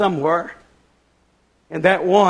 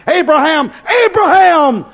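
high call, louder on the repeat; urgent cry; 8 semitones higher, 6 dB louder than usual. Acted. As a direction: cry out the name to stop him. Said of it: "angel of God flying swiftly cried".

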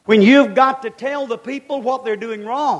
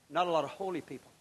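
ordinary delivery

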